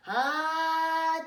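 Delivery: loud, high dragon sneeze; big sneeze. Acted. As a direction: let out a huge sneeze.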